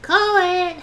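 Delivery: loud, high call; urgent shout. Real, not acted. Player urging others to call the tile, urgent shout. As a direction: shout it impatiently at the table.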